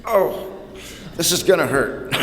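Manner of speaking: high, wincing mock dread; a hammed-up wince before the drink. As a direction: pull a face, dreading the bottle. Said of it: "I'd go".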